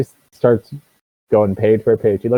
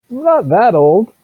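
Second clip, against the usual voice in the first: loud, higher-pitched protest; indignant; 13 semitones higher, 8 dB louder than usual. Acted. Shopkeeper objects to being called old guy, indignant. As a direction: protest it, raised and emphatic.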